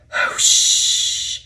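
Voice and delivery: long voiceless hiss of steam; steam whoosh. A narrator's reading. Engines block Duck from the shed, hissing steam. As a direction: imitate an engine letting off steam.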